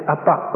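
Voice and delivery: "Appa" with the final "pa" emphasised; stress on second syllable. Shown as a demonstration. Preacher demonstrating Aramaic intonation, "Appa" stressed on its second syllable. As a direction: put the weight on the end of "Appa". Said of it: "with the emphasis on the second syllable".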